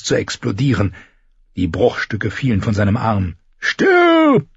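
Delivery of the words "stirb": loud high barking shout; barked. A narrator's reading. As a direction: bark it like a brute. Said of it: "bellte der Schwertkämpfer".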